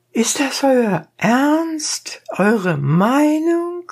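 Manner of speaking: very high, slow, cool question; cold-blooded. A narrator's reading. Donkey driver coolly questions the dentist, very high. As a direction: ask it coolly, drawn out. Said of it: "mit der ganzen Kaltblütigkeit".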